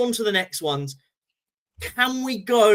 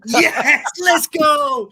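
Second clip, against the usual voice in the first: excited shout; 6 semitones higher, 7 dB louder, much slower than usual. Real, not acted. Celebrating the signing, calling out.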